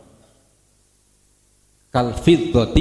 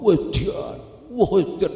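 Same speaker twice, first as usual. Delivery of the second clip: high, drawn-out mock amazement; amazed exclamation; 7 semitones higher, slower than usual. Someone chatting exclaims over everything, in high mock amazement. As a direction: exclaim it high like an impressed gossip. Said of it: "Anggur ngomong".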